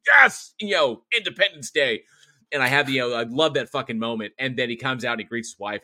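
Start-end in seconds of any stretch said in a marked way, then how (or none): 0.1-2.0 high, loud triumphant cheer; mock cheer. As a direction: cheer like the hero just won. Cheering the rat's death.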